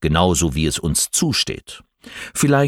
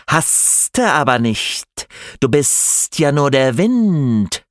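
slow, higher hissing snake lisp; slushy lisp; 6 semitones higher, slower than usual. A narrator's reading. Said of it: "lispelte die Schlange".

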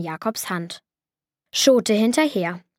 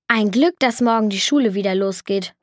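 plain reading voice

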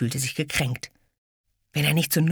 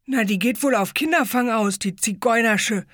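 put-on fat boy; 8 semitones higher than usual. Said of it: "mischte sich ein dicker Junge ein".

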